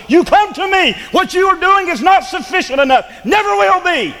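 building to a high, driving pulpit cry; full-force preaching. Acted. Preacher voicing Christ to the restless laborer, full-force preaching. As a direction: climb steadily in pitch, pressing every phrase.

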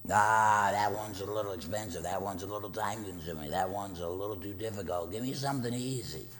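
lampoon a lazy believer making excuses; mock lazy churchgoer.

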